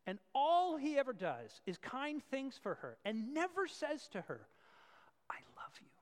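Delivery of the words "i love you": whispered. Acted. Husband telling wife he loves her.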